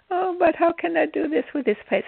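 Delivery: high, whiny imitation of her protest; hammed-up helpless complaint; mock complaining. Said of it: "she kept on complaining".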